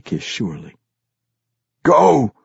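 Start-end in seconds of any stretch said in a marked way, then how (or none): 1.8-2.3 raised command, the second higher and louder; commander's shouted send-off; urgent order. A narrator's reading. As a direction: order them away, building. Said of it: "he ordered".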